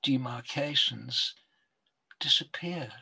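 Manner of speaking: slow, measured poetry recital with long pauses; poem reading. Acted. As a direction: read it like a poet savouring each line.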